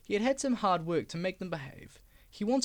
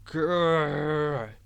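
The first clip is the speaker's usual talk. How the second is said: low loud growl; 3 semitones lower, 6 dB louder than usual. Acted. Diesel mocked by trucks, loud growl. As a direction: growl in sulky anger. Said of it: "He growled".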